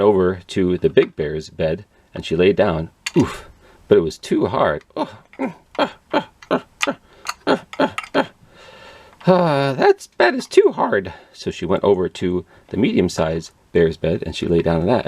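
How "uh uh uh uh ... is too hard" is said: Goldilocks straining. A narrator's reading. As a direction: strain and wriggle like a little girl. Goldilocks straining on hard bed.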